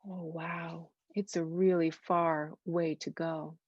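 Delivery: ordinary delivery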